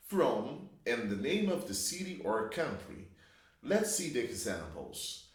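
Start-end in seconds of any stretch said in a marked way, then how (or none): none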